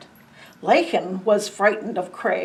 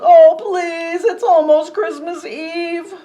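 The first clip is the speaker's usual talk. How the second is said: high, loud pleading wail; 8 semitones higher, 8 dB louder, slower than usual. Acted. Tika wailing at the stuck reindeer, high despairing plea. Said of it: "Tika wailed".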